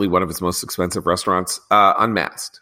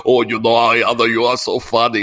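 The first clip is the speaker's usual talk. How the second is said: lightly hammed German accent, a bit louder; mock Schwarzenegger; 4 dB louder than usual. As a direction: tease him with a thick German accent. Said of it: "his thick German accent".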